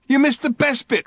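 high, loud outraged complaint; furious he missed it; raging. A narrator's reading. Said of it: "raged Ford".

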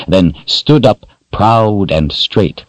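plain reading voice

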